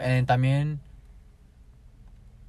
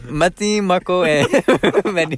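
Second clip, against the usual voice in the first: high, loud giggling intro; laughing through it; 6 semitones higher, 6 dB louder, slower than usual. Pretend. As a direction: break into laughs mid-line. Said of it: "Now do your French".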